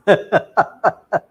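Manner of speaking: loud, rapid burst of chuckles; amused laugh. Real, not acted. Speaker amused by the devotee's excuse, laughing out loud. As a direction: laugh out in quick pulses. Laughs after mimicking the complainer.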